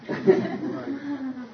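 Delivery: a quick high laugh breaking through; high breathy laugh. Real, not acted. Preacher laughing mid-story at his own Moses bit. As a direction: laugh at your own joke.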